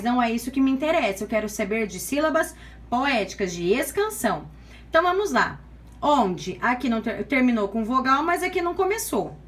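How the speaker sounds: usual speaking voice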